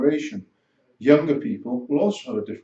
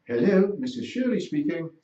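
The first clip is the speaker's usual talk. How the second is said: high-pitched RP telephone voice; professional RP accent; 6 semitones higher than usual. Retold or imitated. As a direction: imitate granny answering a work call in RP. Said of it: "far more professional R P type accent".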